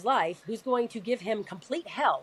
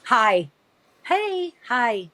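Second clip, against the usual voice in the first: higher, louder, slow sing-song hellos to strangers; mom's overly cheery greeting; 3 semitones higher, 6 dB louder, much slower than usual. Retold or imitated. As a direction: imitate mom gushing hello at everyone in a waiting room. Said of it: "this is her to everybody".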